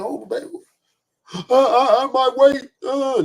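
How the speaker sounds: high mock blubbering, broken by sobs; imitated sobbing wife. Wife crying to husband about her weight, mock sobbing.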